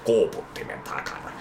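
put-on low, slurred, half-whispered drunk voice; drunk Finn. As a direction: mumble it like a wasted guy in a bush. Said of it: "tulee jotain kännisiä suomalaisia". (matala, sammaltava humalaisen ääni)

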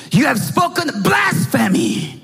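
the furious high priest accusing Jesus; angry accusation. Retold or imitated. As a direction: fling the charge at him. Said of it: "The high priest was so mad".

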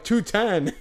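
mock the tiny raise through a laugh; sarcastic echo. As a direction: teller chiming in to mock the new total. He sarcastically echoes the total.

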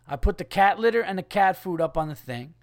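usual speaking voice

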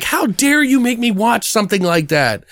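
high-pitched retold scolding; acted-out high, accusing question; imitating girlfriend's outrage. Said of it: "yelling at me".

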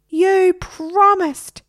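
high, loud, drawn-out squawk; scream. Acted. Parrot repeating accusation to boy, drawn-out scream. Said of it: "screamed Harry".

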